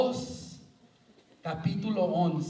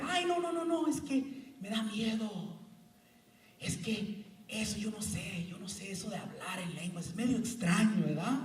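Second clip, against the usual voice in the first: fearful doubter; 3 semitones higher, 6 dB quieter than usual. Retold or imitated. Preacher mocking timid churchgoers afraid of tongues, fearful. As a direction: mimic a nervous skeptic. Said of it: "Y dice gente".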